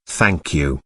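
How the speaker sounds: low, soft tearful thanks; cried thanks. A narrator's reading. To Ruth, Helen cries her thanks softly. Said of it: "she cried".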